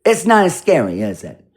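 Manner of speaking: ordinary talk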